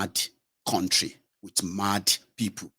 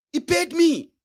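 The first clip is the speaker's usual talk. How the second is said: much higher, louder ranting merchant; outraged businessman; 5 dB louder than usual. Retold or imitated. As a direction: rant high-pitched at the reporter. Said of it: "businessman answers".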